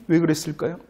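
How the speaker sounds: ordinary talk, nothing marked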